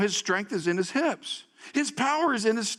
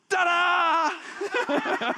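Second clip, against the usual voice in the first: high, laughing showman's ta-da; a hammed-up stage reveal; mock ta-da; 10 semitones higher than usual. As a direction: shout it like a proud magician. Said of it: "he goes".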